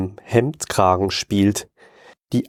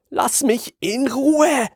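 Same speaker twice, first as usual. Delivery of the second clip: desperate, much higher cry; slower than usual. Acted. Hans desperately crying out at the tentacle man. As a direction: beg to be left alone.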